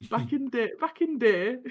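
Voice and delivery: southerner's fake northern English accent; mock northern, said with relish; generic northern accent. Shown as a demonstration. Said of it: "a southerner doing a generic northern accent".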